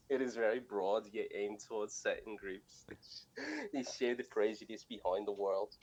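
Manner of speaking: scuffed, drugged-out Aussie accent, cracking up; Australian accent. Shown as a demonstration. Co-host imitating a student's paper for laughs, crazy Australian accent. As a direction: read it in a badly faked Australian accent. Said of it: "drugged out accent".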